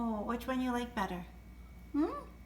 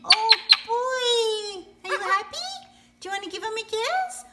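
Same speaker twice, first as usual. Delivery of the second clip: high, bouncy voice for a pet bird; sing-song pet talk; 8 semitones higher, 7 dB louder, slower than usual. Acted. Owner cooing to her parrot about its new toy.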